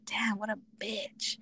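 soft whispered verdict. Retold or imitated. Brother hearing the plot over the phone, whispering. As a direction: breathe it out as a whisper.

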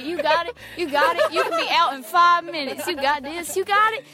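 loud, rising pep-talk chant; hyped-up inner voice. Retold or imitated. Teen voicing her mind urging her on, loud and hyped. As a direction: pump yourself up to steal the truck. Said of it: "My mind's telling me".

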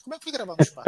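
high, mocking naive reporter. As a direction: mimic a clueless journalist asking. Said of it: "uma repórter perguntou".

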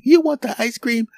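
very high-pitched voice; put-on high voice of a takeout counter worker; food counter worker. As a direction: squeak out the counter question.

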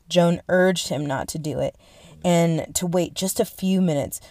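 normal talking voice

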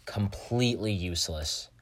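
ordinary delivery

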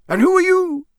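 high, loud challenging question; Mister Roberts. Acted. Campsite Muggle questioning a visitor, high and loud. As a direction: quiz the stranger in a high voice.

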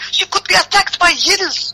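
high-pitched outraged barmaid; crying out. Acted. Barmaid rebukes the child's minder, high cry. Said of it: "cried the barmaid".

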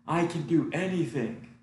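hamming up a cocky star's brag. Retold or imitated. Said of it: "he just says".

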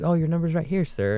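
smooth cashier. Retold or imitated. Cashier slickly handing drunk customer a table number, high and polite. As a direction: imitate a slick, cheery deflection.

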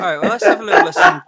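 loud, fast, high jokey announcement; loud announcing. Real, not acted. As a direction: boom it out like a host.

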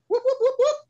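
high, loud siren whoops; imitated police siren. Telling how the patrol car lit him up, imitated siren.

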